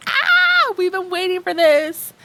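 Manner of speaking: high, squealing fan voice; mock excited gush. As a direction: gush like a fan finally getting it. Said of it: "And I'm just like".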